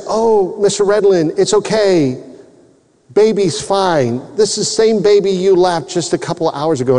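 retold breezy nurse reassurance; reassuring nurse. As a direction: imitate a nurse brushing off a worried father. Reassuring nurse voiced in story.

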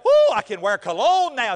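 put-on loud, drawn-out boyish thrill; excited thirteen-year-old. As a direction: whoop it like a thrilled new teenager. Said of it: "thirteen-year-old that".

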